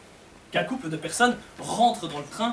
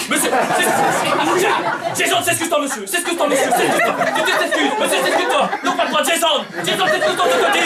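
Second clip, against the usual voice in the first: high, louder frantic mom; flustered mother; 8 semitones higher, 3 dB louder than usual. Acted. Mother ordering Jason to apologize to the passenger, flustered high voice. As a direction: push the kid to apologize, flustered.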